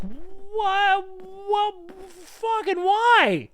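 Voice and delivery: very high, slow and loud dog whine; pleading dog voice. Retold or imitated. Dog looking to its owner after the attack, slow high whine. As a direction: whine it like a hurt, confused dog.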